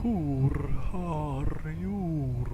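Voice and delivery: high, drawn-out caterpillar puffing sounds; smoking a hookah. Retold or imitated. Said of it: "hitting the hookah".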